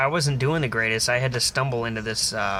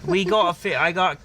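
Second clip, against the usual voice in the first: mock Australian hawker, pitched higher; Aussie fish seller; 6 semitones higher than usual. Bloke pushing fish on a man walking to work, mock Aussie accent. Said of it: "a couple blokes approached me and they're like".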